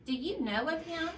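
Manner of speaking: a king gently questioning, voice lifted; second king. Acted.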